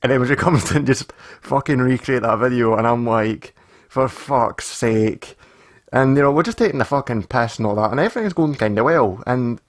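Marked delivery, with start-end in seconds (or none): 3.9-5.4 slow, drawn-out exasperation; exasperated. Retold or imitated. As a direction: stretch out each word in weary disbelief. Exasperated reaction to friends' meme prank.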